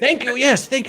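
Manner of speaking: high, eager thank you; excited thanks. Acted. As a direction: burst out with thanks. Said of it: "repeated thanks fading away".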